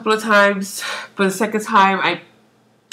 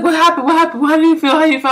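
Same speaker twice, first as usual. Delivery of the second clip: high, louder rapid-fire questions; urgent questioning; 8 semitones higher, 4 dB louder, faster than usual. Retold or imitated. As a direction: fire off questions in mock alarm. Acting out her urgent questions.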